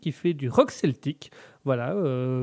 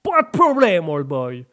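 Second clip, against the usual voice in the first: hammed-up American in French; American accent; 6 dB louder, slower than usual. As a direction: boom it out like a stage American. American character answers the host.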